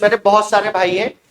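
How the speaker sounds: ordinary talk, nothing marked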